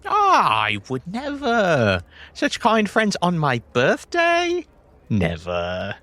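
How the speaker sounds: high mock-innocent protest, dropping on the last word; blatantly insincere. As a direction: swear it so sweetly nobody could believe you. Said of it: "You said that in a way that cannot possibly be anything other than a lie".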